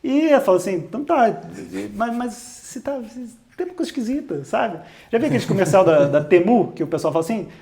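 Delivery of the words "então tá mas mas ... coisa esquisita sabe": hesitant doubt. Retold or imitated.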